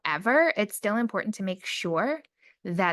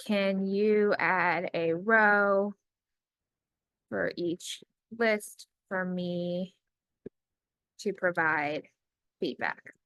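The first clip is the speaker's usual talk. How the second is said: slow, word by word, as if typing along; measured dictating voice with long pauses; slow dictation; much slower than usual. Acted. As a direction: spell out each phrase for the computer. Quoted prompt dictated.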